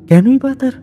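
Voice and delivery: ordinary delivery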